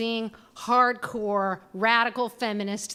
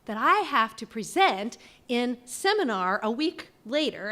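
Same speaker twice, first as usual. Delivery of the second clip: usual speaking voice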